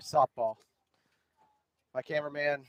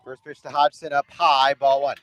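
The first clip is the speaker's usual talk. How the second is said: play-by-play; 3 semitones higher, 6 dB louder than usual. Acted. Live softball play-by-play.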